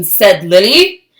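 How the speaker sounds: usual speaking voice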